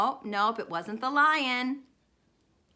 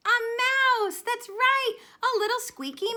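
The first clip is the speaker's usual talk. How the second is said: high mock squeak for a mouse; a playful high squeaky voice; 13 semitones higher, 5 dB louder than usual.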